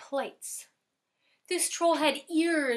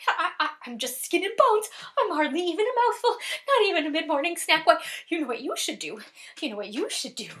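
high, loud, stammering plea; frightened little goat; 6 semitones higher, 7 dB louder, faster than usual. Acted. Littlest goat begging not to be eaten, high and panicky. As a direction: plead quickly as a panicky small goat.

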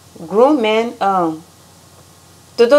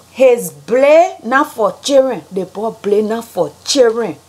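Liberian accent; 2 semitones higher than usual. Shown as a demonstration. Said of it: "how Liberians say things".

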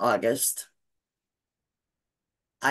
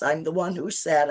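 ordinary delivery

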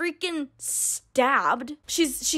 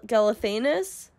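ordinary delivery, nothing marked

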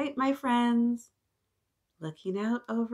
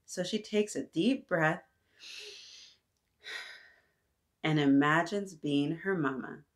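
put-on reading to children; 4 semitones lower than usual. Teacher reading a picture book to the class.

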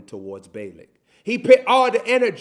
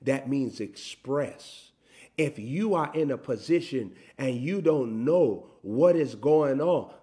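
usual speaking voice

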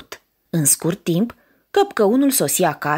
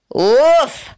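terrifying ogre roar; 3 dB louder, slower than usual. Acted. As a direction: roar like a hungry ogre smelling a child. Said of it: "răcni el cu glas înspăimântător". (răget de căpcăun înspăimântător)

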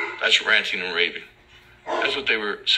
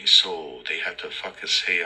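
ordinary delivery

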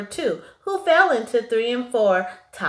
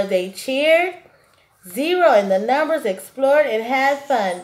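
ordinary delivery

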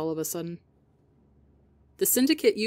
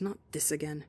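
whisper; 3 semitones lower, 9 dB quieter than usual. Acted. Whispered exasperated line.

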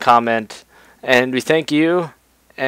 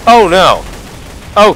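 usual speaking voice

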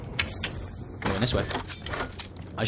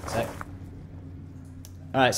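ordinary talk, nothing marked

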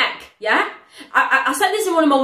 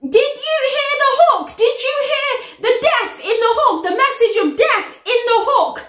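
amazed disbelief; 7 semitones higher than usual. Real, not acted. Vlogger stunned by the death threats in the hook, amazed. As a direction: marvel at the hook, voice up high.

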